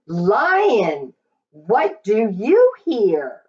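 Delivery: ordinary delivery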